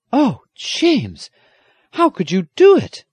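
put-on high, loud girl's outburst. A narrator's reading. Girl bursting in on James, high dismay. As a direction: cry out as an upset young girl. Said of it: "a girl's voice said suddenly".

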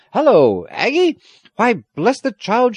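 high, loud surprise; startled exclamation. A narrator's reading. Squire noticing the child has fainted, startled. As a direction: blurt it out, taken aback.

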